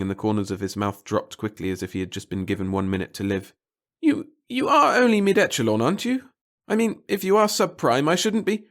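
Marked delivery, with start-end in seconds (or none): 4.0-8.7 high, loud, worried stammer; anxious stammer. A narrator's reading. The clerk suddenly fearing the reporter outranks him. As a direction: panic about having offended a superior. Pettigill alarmed.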